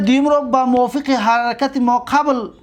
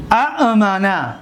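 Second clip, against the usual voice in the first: slow teaching demo of اامنه; slow drawn-out original form; much slower than usual. Shown as a demonstration. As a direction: say اامنه slowly, both hamzas separate.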